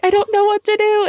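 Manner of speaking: high-pitched, squeaky retold fretting; mock panic. Retold inner panic, laughing before.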